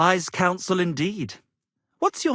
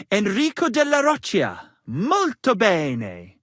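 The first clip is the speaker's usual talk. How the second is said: mock Italian; 4 dB louder than usual. Bard giving Henry an Italian noble name, loud mock Italian. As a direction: savor it like a hammy Italian maestro.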